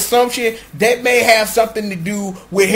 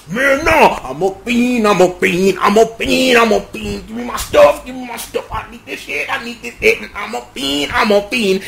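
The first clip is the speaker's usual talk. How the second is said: mock frantic refusal, chanted over and over; arguing fiend. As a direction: act out a stubborn craving in a loop. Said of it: "they still arguing".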